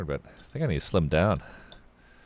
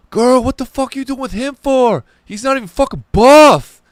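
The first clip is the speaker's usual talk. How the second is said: loud, high-pitched jeering yell; catcalling heckle; 15 dB louder than usual. Retold or imitated. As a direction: holler slowly from a passing car, mocking. Acts out heckling guys in a car.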